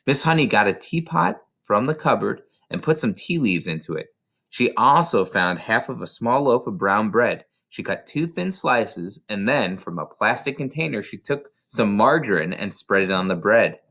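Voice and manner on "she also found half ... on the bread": plain reading voice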